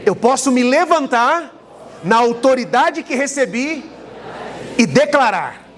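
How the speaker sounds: declaration for the church to repeat. Shown as a demonstration. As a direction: lead them in a bold proclamation. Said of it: "diga assim comigo".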